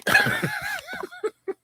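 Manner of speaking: squeaky burst of laughter; high squealing laugh. Real, not acted. Laugh after quote.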